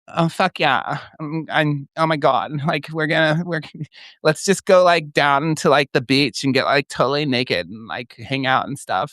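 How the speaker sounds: high excited airhead voice; Crystal voice. Shown as a demonstration. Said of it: "We called it Crystal".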